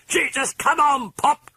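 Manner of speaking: high, piercing screech at her father; shrill pleading scream. Acted. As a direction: screech it out in desperation. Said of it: "she screeched".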